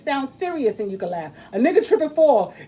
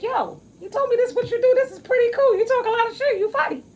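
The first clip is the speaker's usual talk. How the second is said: high quoted voice; 11 semitones higher, faster than usual. Retold or imitated. Quoting people praising her.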